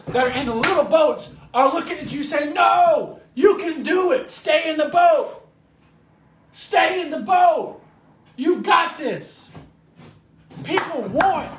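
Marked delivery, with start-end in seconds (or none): 2.5-9.5 loud, high shouted encouragement; rally them, loud and drawn-out; cheering encouragement. Acted. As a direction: shout slowly to someone about to give up. Friends in boats cheering.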